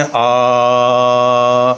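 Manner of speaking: आ held long and steady. Shown as a demonstration. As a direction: draw out आ in one long tone. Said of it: "आ का उच्चारण लंबा करते हैं".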